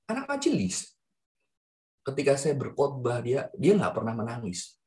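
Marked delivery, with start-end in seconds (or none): none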